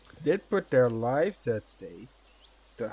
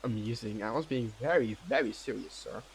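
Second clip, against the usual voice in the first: mock serious; 9 dB quieter than usual. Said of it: "I look at him like".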